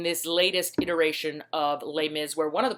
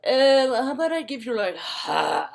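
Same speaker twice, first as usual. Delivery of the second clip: higher put-on posh British voice; hammed-up upper-crust British haggling; snotty British haggler; 6 semitones higher, 3 dB louder than usual. Posh customer haggling over the price, snotty British accent. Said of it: "snotty, upper crusty British dude".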